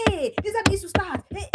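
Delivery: a touch of an alarmed mother; fearful scolding parent. Retold or imitated.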